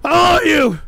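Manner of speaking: loud bellow at everyone; furious shout. Real, not acted. Streamer screaming at his whole chat room to shut up. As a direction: scream them down.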